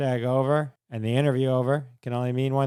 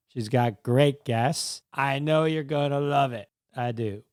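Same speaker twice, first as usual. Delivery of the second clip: ordinary talk, nothing marked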